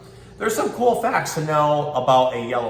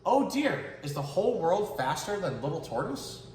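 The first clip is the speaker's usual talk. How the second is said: soft, higher dismay; 7 semitones higher, 6 dB quieter than usual. Acted. Little tortoise falls behind everyone, soft dismayed voice. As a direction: sigh it with small worry.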